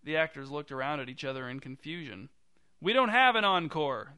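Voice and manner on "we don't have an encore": raised, loud voice carrying to the audience; calling back. A narrator's reading. Jerry answering the director's husband from the stage, calling back. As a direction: call it out high and loud. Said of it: "Jerry called back".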